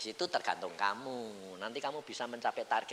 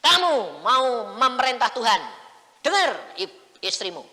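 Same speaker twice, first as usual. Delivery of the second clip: high, loud, slow rebuke as God; father retelling God's loud rebuke; God rebuking; 12 semitones higher, 12 dB louder, slower than usual. Retold or imitated. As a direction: scold as God. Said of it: "Dia bilang".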